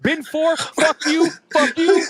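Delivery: squeaky exasperated yelling; angry traveler. Retold or imitated. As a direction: rant like a furious passenger who's had enough. Angry man yelling at airport.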